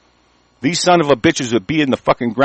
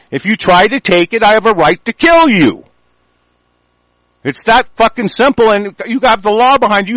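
higher and louder, shouting his fury; furious ranting; 6 semitones higher, 4 dB louder than usual. Real, not acted. Ranting at viewers about his right to kill attackers, furious shouting. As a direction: hammer each claim home, raging.

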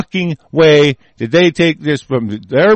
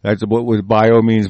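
normal talking voice

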